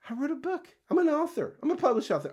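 high, thrilled announcement; giddy pride. Acted. Speaker marvels at becoming an author, giddy pride. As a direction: pitch jumps up in proud amazement. Imagining pride at having written a book.

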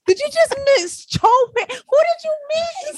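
squealing mock outrage; incredulous high shout. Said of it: "I said".

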